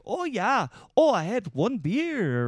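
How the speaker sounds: high, singsong drunk Minnesotan impression; hammed-up, high Upper Midwest lilt; mock Norwegian-Midwestern accent. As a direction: imitate a tipsy friend's Norwegian-tinged Midwestern accent. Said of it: "this Norwegian".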